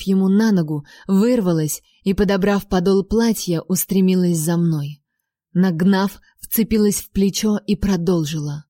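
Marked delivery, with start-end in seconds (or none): none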